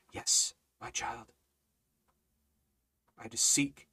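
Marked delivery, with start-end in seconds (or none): 0.1-1.3 whisper. Retold or imitated. God answers the praying believer.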